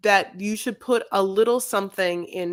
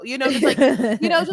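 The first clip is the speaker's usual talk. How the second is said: ordinary talk, nothing marked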